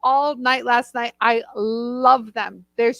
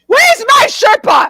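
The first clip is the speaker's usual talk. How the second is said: mock explorer call; 11 semitones higher, 8 dB louder than usual. Echoes friend's joke, louder.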